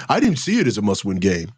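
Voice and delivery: low, fast mock of the coach-speak line; lightly voiced imitation of George, dismissive; mock quote from George. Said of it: "And here you go".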